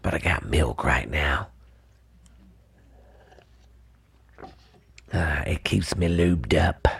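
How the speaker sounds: whispered aside about the milk. Real, not acted. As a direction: whisper it with a dry mouth. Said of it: "dry mouth so bad".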